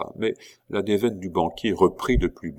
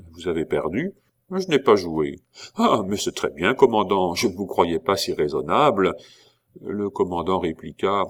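usual speaking voice

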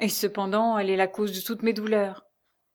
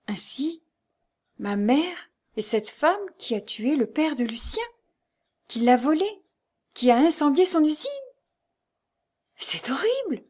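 horrified stammer; 6 semitones higher than usual. A narrator's reading. Lucie learning her mother was condemned for murder, horrified. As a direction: build from faltering disbelief to high horror. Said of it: "rompit ce silence en balbutiant".

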